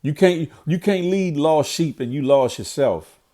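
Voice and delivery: ordinary delivery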